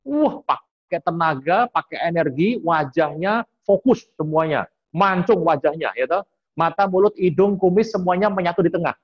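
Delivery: wholehearted intensity. Shown as a demonstration. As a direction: push each phrase out with all your energy. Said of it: "sepenuh hati itu".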